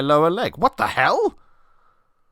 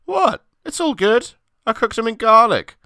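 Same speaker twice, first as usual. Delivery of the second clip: much higher, carefree innocent voice; innocent friend voice; 12 semitones higher, 5 dB louder than usual. Acted. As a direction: sound pleased and clueless. Chris voiced innocently in story.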